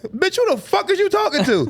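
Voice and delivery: very high indignant comeback; high-pitched confrontational retort; mock outrage. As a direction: imitate a woman firing back, pitch way up. Acted angry comeback in story.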